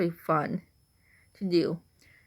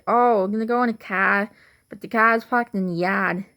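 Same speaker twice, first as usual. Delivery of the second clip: Boston accent with dropped R in car and yard; 4 dB louder than usual. Shown as a demonstration. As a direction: put on a loud Boston accent. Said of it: "in the Boston accent".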